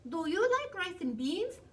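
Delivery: high-pitched little-dog question; high, perky creature voice; small chihuahua voice. A narrator's reading. Another small chihuahua questions hero.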